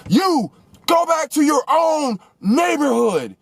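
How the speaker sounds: high, slow, shouted mockery of the old driver; hammed-up shout from a car window; mock angry yell. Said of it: "he's like".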